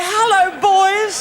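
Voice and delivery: loud, slow call to the band. Acted. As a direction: call across to the orchestra. Calls out to the orchestra.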